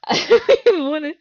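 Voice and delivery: loud, high, drawn-out protest; reluctant wail. Real, not acted. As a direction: wail it slowly with a laugh of dread. Dreads continuing the game.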